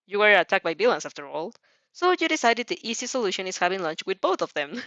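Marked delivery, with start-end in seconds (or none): none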